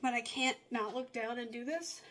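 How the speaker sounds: ordinary talk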